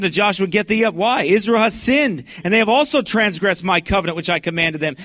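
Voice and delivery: loud, high street preaching; full-force preaching. Acted. Street preacher thunders scripture at passersby, loud and high.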